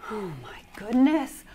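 usual speaking voice

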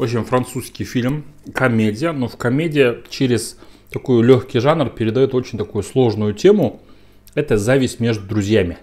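talking between bites; chewing while talking. Real, not acted. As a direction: mouth partly full. Seen 0: Bites food from fork near -2s, then jaw works while speaking.

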